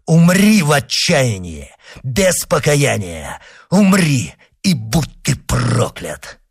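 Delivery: high, forceful curse, slowing at the end; vengeful curse. Acted. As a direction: hurl the curse at the dying man. Avenger curses dying man.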